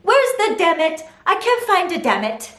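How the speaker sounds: put-on high toddler whine, hands up. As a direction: play the searching two-year-old. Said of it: "starts with her hands up going".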